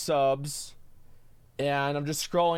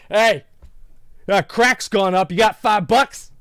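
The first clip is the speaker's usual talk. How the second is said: loud, higher mock panhandler hitting him up; mock homeless guy; 5 semitones higher, 7 dB louder than usual. Retelling a homeless guy begging for five bucks, loud mock plea. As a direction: ham up a beggar asking for cash, loud and high. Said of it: "he's like".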